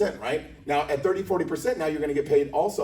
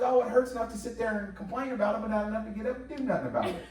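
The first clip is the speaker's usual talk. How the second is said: higher, easygoing auntie voice; breezy, unbothered older lady; Auntie Deborah; 7 semitones higher than usual. Retold or imitated. The auntie brushes off her nephew's worry, in a high easygoing voice. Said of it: "She goes".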